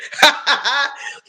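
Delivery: high amused laugh; real laugh at the memory. Real, not acted. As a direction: burst out laughing at it.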